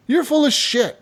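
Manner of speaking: high-pitched mock heckle; jeering crowd. Crowd doubting the magician's bottle trick, jeering. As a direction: call it out like a disbelieving audience.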